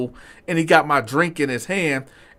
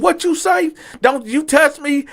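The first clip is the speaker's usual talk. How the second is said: angry threat; 12 semitones higher than usual. Retold or imitated. Diner standing up to the waiter, high and threatening. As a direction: rise up and threaten him.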